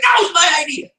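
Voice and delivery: high, outraged little cry; a playful, high protest at a stolen invention; mock indignant surprise. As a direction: act cheated out of your own idea. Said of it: "And you're like".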